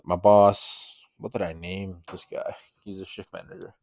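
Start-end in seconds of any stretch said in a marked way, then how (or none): 1.2-3.7 very soft aside to oneself; quiet self-talk. Real, not acted. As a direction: think aloud under your breath. Asks himself a forgotten name.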